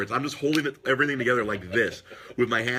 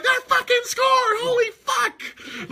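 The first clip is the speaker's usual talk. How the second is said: much higher, louder, slow stretched yell; Gus Johnson screaming; 6 dB louder, much slower than usual. Retold or imitated. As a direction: scream the call like the announcer losing it. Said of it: "He freaking screams in the mic".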